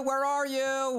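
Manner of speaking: calling out. Pretend. Calling to brother far off.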